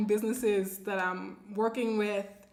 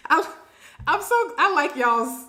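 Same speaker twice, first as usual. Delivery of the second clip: higher, louder, breaking out of a laugh; amused, laughing; 5 semitones higher, 6 dB louder than usual. Real, not acted. Host tickled by a viewer's blunt solution, laughing. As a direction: laugh through the start of the words.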